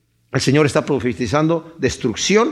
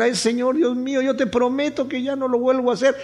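higher, softer, imitating a pleading sinner; mock-beg God, Ay Señor with hands wrung in the voice; desperate pleading prayer; 8 semitones higher, 4 dB quieter than usual. Preacher imitating a sinner begging God in despair, higher and softer. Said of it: "clamamos al Señor en la desesperación".

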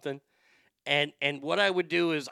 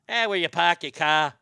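louder, r-dropping Boston accent; 5 dB louder than usual. Shown as a demonstration. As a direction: drop the R's like a Bostonian. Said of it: "That in Boston".

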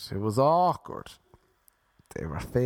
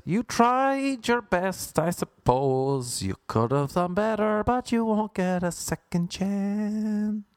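mock condescending; 7 semitones higher, slower than usual. Mock post-sex verdict.

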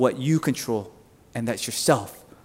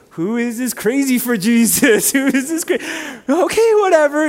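higher-pitched incredulous aside; mock bewilderment; 9 semitones higher than usual. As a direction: act out baffled disbelief. Acting his own bemused reaction.

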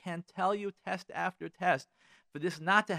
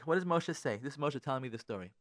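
ordinary talk

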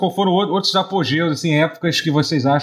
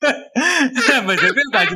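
rindo; 7 semitones higher, much slower than usual. Real, not acted. Caindo na risada.

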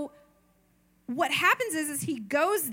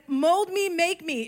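mock pious prayer; 6 semitones higher, 6 dB louder than usual.

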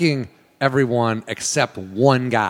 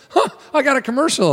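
high, surprised and pleased; delighted to land the commercial; excited surprise; 13 semitones higher than usual. Retold or imitated. Actor learning he booked the job, high surprised delight. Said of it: "I was like".

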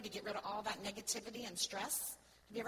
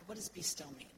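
usual speaking voice

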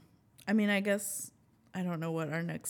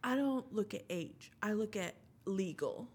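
slow retold quote; slower than usual. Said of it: "he was like".

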